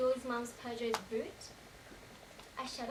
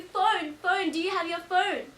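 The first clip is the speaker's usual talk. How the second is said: raised, loud urgent demand; demanding shout; 5 semitones higher, 9 dB louder, slower than usual. Acted. Survivor confronting Julia over the phone, demanding shout. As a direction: demand it loudly, pitched up.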